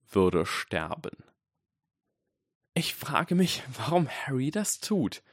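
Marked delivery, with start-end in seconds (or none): 2.7-5.2 put-on higher-pitched musing girl; thoughtful, high-voiced wondering; reflective. A narrator's reading. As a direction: wonder it aloud, pondering. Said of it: "in einem reflektierten Ton".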